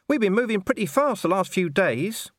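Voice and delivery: high shrugging dog voice; small dog voice. Acted. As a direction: shrug it off like a scruffy mutt. Gaspode continues.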